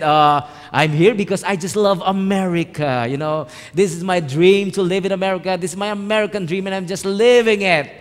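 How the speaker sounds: excited, emphatic self-justifying reply; gushing enthusiasm. Retold or imitated. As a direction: gush about living the dream. His gushing answer to the voice.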